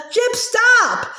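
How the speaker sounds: sharp high cry to halt the dog; shout. Acted. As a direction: yell after a runaway dog. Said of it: "shouted Samantha".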